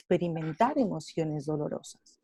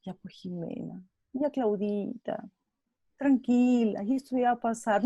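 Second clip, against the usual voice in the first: soothing hush; 4 semitones higher than usual. Retold or imitated. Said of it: "lo primero que usualmente hacemos es".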